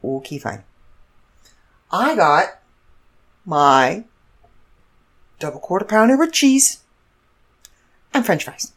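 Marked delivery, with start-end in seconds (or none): none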